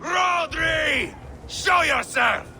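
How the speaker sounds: drawn-out, high war call; calling out a foe. Acted. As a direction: bellow the challenge up at the walls. Calling out enemy at the walls.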